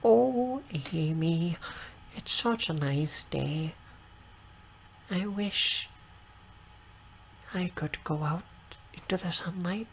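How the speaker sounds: high, soft doting aunt voice; squealing baby talk aunt. Acted. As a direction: coo it sweetly and softly. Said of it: "This voice is very, very odd".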